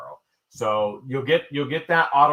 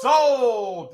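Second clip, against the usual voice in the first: shouted sold; 10 semitones higher than usual. Real, not acted. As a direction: belt it out like a gavel drop. Auction closing shout.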